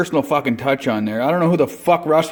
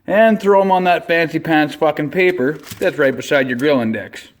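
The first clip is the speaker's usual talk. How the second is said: ordinary delivery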